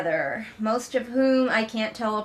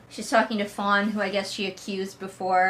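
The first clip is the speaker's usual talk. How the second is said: ordinary talk, nothing marked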